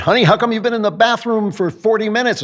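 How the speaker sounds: high-pitched mock wife voice; imitated wife. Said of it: "My wife is, like, outside the bathroom going".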